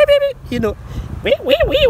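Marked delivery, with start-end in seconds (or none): 0.1-0.4 high, sharp mock horn beeps; imitated car horn beeps. Cop car honks behind him, imitated beeping. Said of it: "he just comes behind me with a beep". 1.2-1.9 high, wailing mock siren; imitated police siren. Police car pulls him over, imitated siren wail. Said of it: "wee sound".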